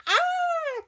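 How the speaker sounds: high, loud mock scream; sudden high yelp; frightened cry. A narrator's reading. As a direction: shriek in puppet-show fright. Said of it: "cries Susie, making Owlie jump in fright".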